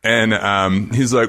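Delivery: normal talking voice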